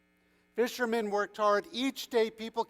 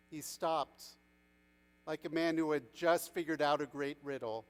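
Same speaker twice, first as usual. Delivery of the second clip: ordinary delivery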